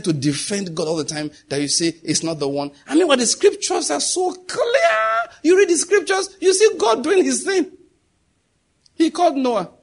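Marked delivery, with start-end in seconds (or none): 2.9-9.7 high, loud, crying out the words; fervent preaching shout. Real, not acted. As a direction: cry it out like a preacher at full force. Preacher erupts at full force.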